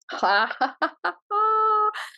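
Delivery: bright giggle of delight; delighted high laugh. Real, not acted. Reader thrilled by the Fool card, high laugh. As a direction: laugh with real joy, high.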